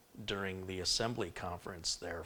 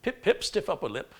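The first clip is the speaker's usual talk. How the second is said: high, louder posh British gentleman; 9 semitones higher, 5 dB louder than usual. Shown as a demonstration. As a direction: put on a sophisticated, upper-class British accent. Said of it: "the posh British is pretty simple".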